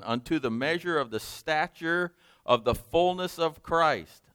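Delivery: preacher's solemn pulpit reading; scripture reading. Acted. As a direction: proclaim the verses like a preacher reading aloud. Reads scripture aloud from pulpit.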